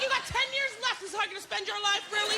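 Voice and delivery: high, exasperated mock retort; impatient retort. Impatient reply to senior.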